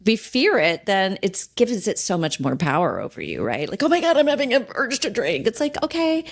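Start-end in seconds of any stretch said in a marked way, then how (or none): 3.8-5.5 high, fast, alarmed mock-panic. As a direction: rush it out like a client scared of the urge. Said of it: "not panicking".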